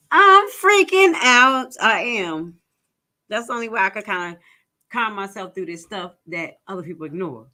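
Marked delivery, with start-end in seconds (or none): 0.1-1.8 loud, high, stretched-out outburst; freaking out. Real, not acted. Host overreacting to research coming true, loud freak-out. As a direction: wail it loudly, drawing out each word.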